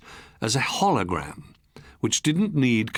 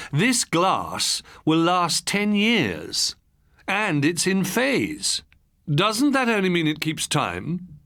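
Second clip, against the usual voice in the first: lightly voiced wife, higher and slow; a hint of the wife, slow and higher; wife's voice; 7 semitones higher, slower than usual. A narrator's reading. Narrator voicing his wife questioning the seller, lightly higher.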